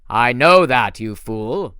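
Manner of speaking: the general booming at his fool of a servant. Acted. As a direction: boom it out with contempt. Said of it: "boomed the man".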